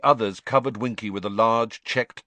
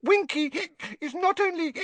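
high, indignant elf squeak; 4 dB louder than usual. A narrator's reading. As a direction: squeak it out offended. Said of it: "Winky squeaked indignantly".

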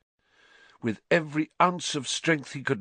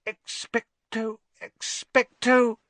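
faltering; 11 semitones higher, slower than usual. A narrator's reading. Hermione weakly tries again.

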